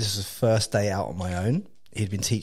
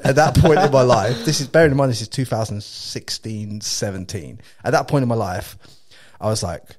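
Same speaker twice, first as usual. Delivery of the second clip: ordinary delivery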